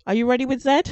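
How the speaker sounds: loud rising call. Real, not acted. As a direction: call it out eagerly. Host calling out question.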